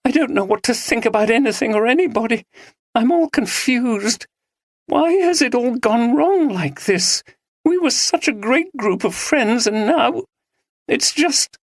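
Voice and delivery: high, confused and tearful; lost and close to tears; distraught. A narrator's reading. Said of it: "She trailed off".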